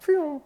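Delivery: high, drawn-out whoosh; imitated zooming off. Storyteller mimics taking back off, high slow whoosh. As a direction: send out a high zipping noise. Imitating himself speeding away.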